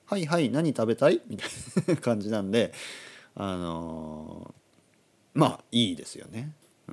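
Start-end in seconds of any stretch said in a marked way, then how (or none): 0.1-1.2 higher-pitched obliging mom; lightly voiced agreeable mom; indulgent mother. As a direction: give in like a doting mother. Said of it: "僕のお母さんは".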